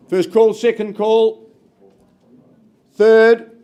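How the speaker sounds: loud rapid-fire auction calls; frantic trading floor shouting. Real, not acted. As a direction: punch out each call to the room. Auctioneer counting down the bid.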